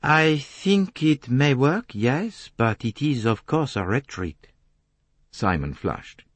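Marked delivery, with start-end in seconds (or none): 0.0-4.5 Poirot, deliberating. Acted. Poirot judging Simon's plan, deliberate. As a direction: weigh each phrase as the detective.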